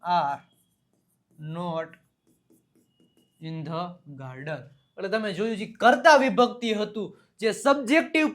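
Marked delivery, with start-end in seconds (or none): 0.0-4.6 very slow, long pauses between words; slow dictation. Shown as a demonstration. Teacher dictating the answer to students, very slow. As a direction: lay each word down for the class. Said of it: "શું આવશે?".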